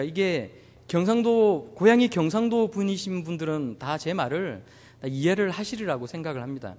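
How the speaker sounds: normal talking voice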